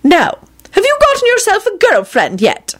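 put-on high, stern elderly lady; stern old woman. A narrator's reading. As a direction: ask it sternly. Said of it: "gave him a stern look".

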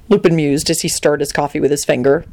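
plain reading voice

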